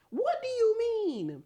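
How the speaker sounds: high, drawn-out incredulous question; really appalled, pitch way up; exasperated disbelief. Real, not acted. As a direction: stretch it out in a high squeal. Outraged at the boyfriend's response.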